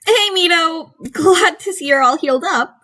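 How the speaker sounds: called across the room, pitched high; calling out. A narrator's reading. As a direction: greet him from across the room, high and bright. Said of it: "she called out".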